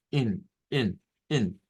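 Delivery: in, short clipped i sound; teacher isolating a syllable; isolated syllable demo. Shown as a demonstration. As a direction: tap out in on its own. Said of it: "Es in".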